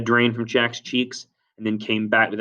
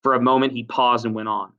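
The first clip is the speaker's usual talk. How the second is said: ordinary delivery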